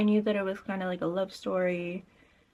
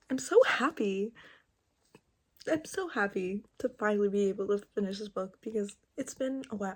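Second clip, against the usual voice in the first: ordinary talk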